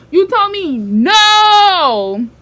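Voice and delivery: put-on drawn-out indignant yell at a lying boyfriend; outraged disbelief. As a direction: stretch every word, you can't believe he lied.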